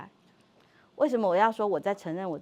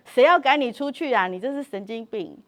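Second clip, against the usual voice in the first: exasperated retort; 4 semitones higher, 6 dB louder than usual. Retold or imitated. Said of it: "我说".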